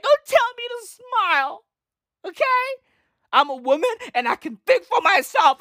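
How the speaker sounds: high, defiant hammed-up protest; mock indignant woman. Parody woman scolding men who say smile, defiant. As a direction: strut it out like a sassy parody.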